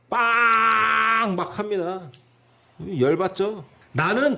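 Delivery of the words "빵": long, held honk made with the voice; imitated car horn. Lecturer mimicking an impatient driver's horn, drawn-out honk. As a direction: blare one sustained high horn note. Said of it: "빵빵이로 그냥 막 눌러댑니다".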